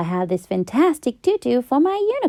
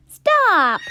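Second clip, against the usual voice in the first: yell; 9 semitones higher than usual. Acted. Mom yelling at the unicorn, a sharp high yell. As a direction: call out to stop the unicorn. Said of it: "I yelled".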